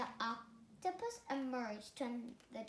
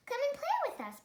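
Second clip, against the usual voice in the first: high, bright call to play; 13 semitones higher, 6 dB louder, much faster than usual. Acted. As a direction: call him over to come play. Fish calling out to Rainbow Fish.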